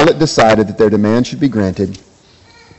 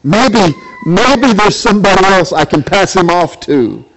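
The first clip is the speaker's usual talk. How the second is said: higher, worried inner voice of Pilate; Pilate fretting; 6 semitones higher than usual. Acted. Pilate talking to himself, anxious, looking for a way out. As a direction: fret like a trapped governor. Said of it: "Pilate says to himself".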